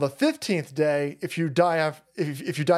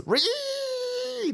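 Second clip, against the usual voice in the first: a high squealing ree shriek; high meme screech; 3 dB quieter than usual. Pretend. Streamer screeching ree at his chat, high and squealing.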